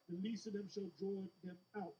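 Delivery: normal talking voice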